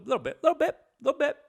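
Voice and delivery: tiny high squeezed voice; teasing. Pretend. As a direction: repeat it high and small, like a guilty admission. Teasing about secret arousal.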